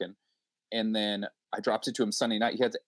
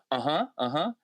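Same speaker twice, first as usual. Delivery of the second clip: higher, eager uh-huh; mock backchannel; 6 semitones higher than usual. Host talking over a brilliant guest, mock eager.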